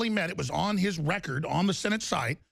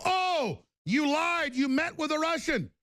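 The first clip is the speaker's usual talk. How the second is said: mocking accusers; 7 semitones higher, slower than usual. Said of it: "and they go".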